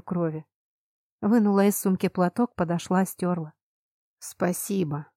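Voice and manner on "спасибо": softer, hoarse thank-you. A narrator's reading. Prince thanks the heroine for wiping his cheek, hoarse. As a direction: rasp out the thanks. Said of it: "хрипло поблагодарил принц".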